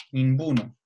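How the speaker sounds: "ninguno" said as nimbuno; B sound for G. Shown as a demonstration. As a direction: close the lips for a B in "ninguno". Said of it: "a lo mejor sería". ("ninguno" con B en lugar de G)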